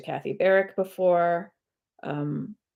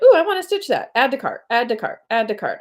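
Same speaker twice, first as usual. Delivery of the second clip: excited shopping; 5 semitones higher, 5 dB louder, faster than usual. Retold or imitated. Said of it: "I was like".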